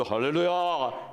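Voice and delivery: slow, drawn-out solemn pastor's "Hallelujah"; 근엄한 목사 목소리. Shown as a demonstration. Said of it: "목소리도 ... 이렇게 해야 되는데". (길게 늘인 근엄한 목사 말투)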